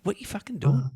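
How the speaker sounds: high-pitched exasperated jab; exasperated ribbing. Real, not acted. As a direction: scold a co-host who has drifted off. Co-host caught not listening, ribbed.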